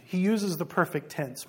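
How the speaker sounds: usual speaking voice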